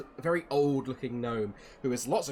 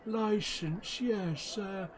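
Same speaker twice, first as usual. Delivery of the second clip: put-on high, fumbling old man voice; doddery gnome hunting for his license; flustered old gnome, high and hesitant; old gnome Silas; 9 semitones higher, 4 dB louder, slower than usual.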